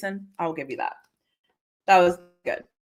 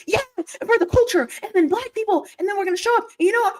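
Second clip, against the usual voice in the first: hyped-up mock quote; 12 semitones higher, faster than usual. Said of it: "I'm like".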